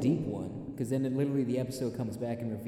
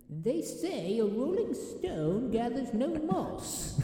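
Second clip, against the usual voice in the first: high, slow sassy British voice; Pink Panther voice; 8 semitones higher than usual. Acted. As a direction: purr it like a smug butler. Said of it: "that's just the Pink Panther voice".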